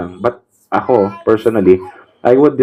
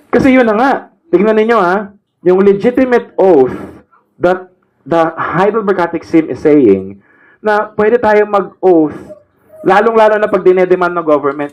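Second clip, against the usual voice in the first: fiery preaching; 8 semitones higher than usual. Acted. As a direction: preach it out to the congregation. Preaches at full force.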